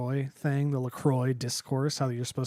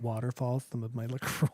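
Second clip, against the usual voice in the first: normal talking voice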